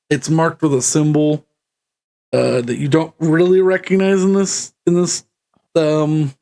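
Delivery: normal talking voice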